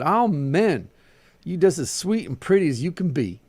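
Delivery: high, fervent gushing; flattering old woman. A narrator's reading. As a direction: pile on the praise like an amen chorus. Old woman flattering her, voiced.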